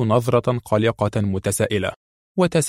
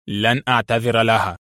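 plain reading voice

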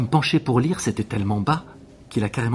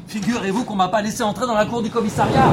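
high, raised voice over noise; indignant calling out; 10 semitones higher than usual. Acted. Witness justifies his parking to police, high indignant call.

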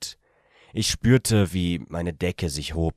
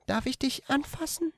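much higher, softer female voice; young woman asking softly; 5 dB quieter than usual. A narrator's reading. The woman asks permission, in a much higher, softer voice. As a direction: ask shyly, hushed.

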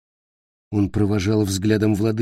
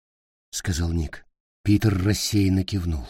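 usual speaking voice

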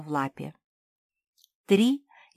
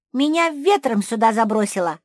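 calling out; 5 semitones higher, 8 dB louder than usual. A narrator's reading. Calling down from the treetop.

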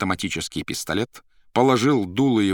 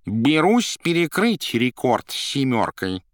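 slow, higher, put-on cat voice; cat's announcement; 6 semitones higher, 4 dB louder, slower than usual. A narrator's reading. Sulking cat suddenly announcing a challenge, slow high voice. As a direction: declare it slowly and grandly. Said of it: "и вдруг объявил".

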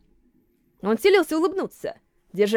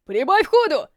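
raised urgent shout; 4 semitones higher, 3 dB louder, slower than usual. A narrator's reading. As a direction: yell to hurry him on. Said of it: "крикнул раненый".

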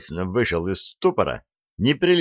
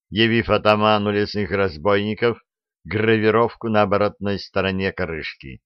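plain reading voice

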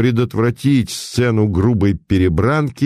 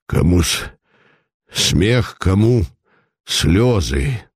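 mild displeased grumble; slower than usual. A narrator's reading. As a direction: grumble it like a hurt old man. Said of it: "недовольно пробурчал". (недовольное ворчание, сдержанно)

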